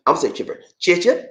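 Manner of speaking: normal talking voice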